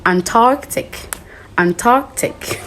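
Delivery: Antarctic in an American accent, with the R said, ant-ARK-tic; American pronunciation. Shown as a demonstration. Teacher showing students the American accent: Antarctic. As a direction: Antarctic as an American says it, R fully sounded. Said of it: "Americans will go".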